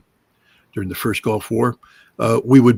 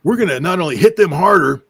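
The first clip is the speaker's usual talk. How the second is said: higher, louder mock blustering threat; mock tough threat; 8 semitones higher, 5 dB louder than usual. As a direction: ham up a tough-guy warning, pitched up. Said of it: "president and Pete Hegseth come out and say".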